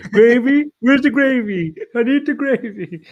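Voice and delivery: high, whiny cartoon dad asking; high mock Randy hunting for gravy. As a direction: squeak it out like a lost cartoon dad. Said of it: "Randy's just walking down and he's like".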